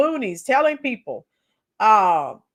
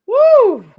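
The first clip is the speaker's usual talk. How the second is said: loud whoop; 11 semitones higher, 10 dB louder than usual. Real, not acted. Cheering after laughing.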